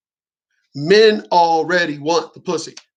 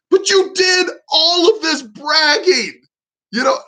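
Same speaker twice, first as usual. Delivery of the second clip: teasing disbelief; 12 semitones higher, 3 dB louder than usual. Retold or imitated. Teasing her about bragging.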